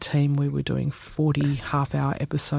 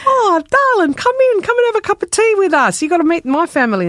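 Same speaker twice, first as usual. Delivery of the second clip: much higher, delighted and welcoming, a bit quick; delighted auntie; 8 dB louder than usual. Retold or imitated. Auntie inviting the journalist in for tea, high delighted voice. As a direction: gush over him like long-lost family. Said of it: "And she goes".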